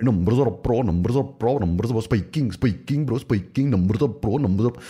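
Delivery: put-on high, singsong Indian-accented repetition; hammed-up imitation of excited Indians; mock Indian chatter. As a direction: parrot it over and over, excitably. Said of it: "India's a fucking love saying that".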